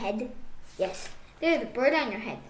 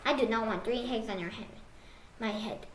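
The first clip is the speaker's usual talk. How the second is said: plain reading voice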